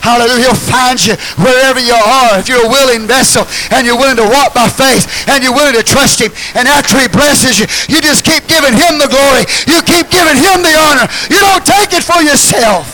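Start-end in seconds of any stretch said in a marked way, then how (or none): 2.4-12.8 rising louder and higher preaching; climbing pulpit fervour; building preaching. Real, not acted. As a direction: build the exhortation to a peak. Sermon rising to a climax.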